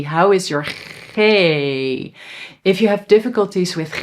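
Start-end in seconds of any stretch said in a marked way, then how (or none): 1.1-2.1 G said the Dutch way, throaty KHAY, drawn out. Shown as a demonstration. Teacher checks learners' Dutch G, long throaty sound. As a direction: hold the scraped Dutch G into a long ay.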